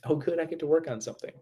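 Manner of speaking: an overjoyed "oh good" said to a setback; mock delighted. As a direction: flip the worry into sudden cheer. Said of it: "to, like".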